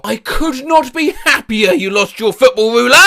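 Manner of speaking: usual speaking voice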